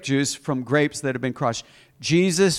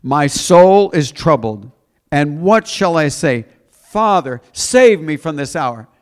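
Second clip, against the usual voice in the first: loud, drawn-out plea from the pulpit; dramatic scripture reading; 6 dB louder than usual. Acted. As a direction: cry the prayer out slowly to the congregation. Reads verse again.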